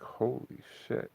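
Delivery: slow stunned whisper; awed whisper. Retold or imitated. To his friend after smoking, a slow awed whisper. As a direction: breathe it out slowly, amazed.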